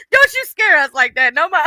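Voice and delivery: high laugh into a loud, teasing don't you; laughing scold. Real, not acted. Streamer teasing J B in chat, laughing. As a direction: giggle while telling him off.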